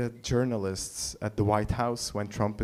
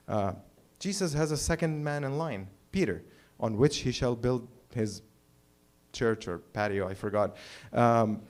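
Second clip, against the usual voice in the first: ordinary talk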